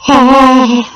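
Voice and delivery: loud startled high gasp; sharp gasp. Real, not acted. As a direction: gasp in shock. Echo warning read out.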